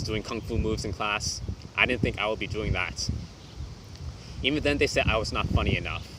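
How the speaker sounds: normal talking voice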